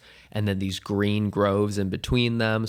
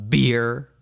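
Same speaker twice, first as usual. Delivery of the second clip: bro voice; 3 semitones higher, 5 dB louder than usual. Pretend. Bro drinking voice.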